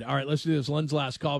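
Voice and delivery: ordinary talk, nothing marked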